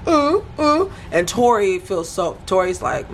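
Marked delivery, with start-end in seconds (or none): 0.1-0.9 high mock-confused grunts; playing dumb. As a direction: imitate someone acting baffled, pitched way up. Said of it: "he's acting like".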